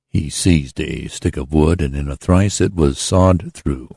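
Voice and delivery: ordinary delivery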